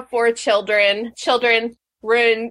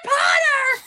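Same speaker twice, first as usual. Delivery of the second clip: imitating a kid screaming. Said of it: "Lee just kept screaming".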